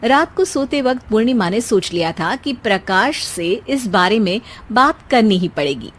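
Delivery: plain reading voice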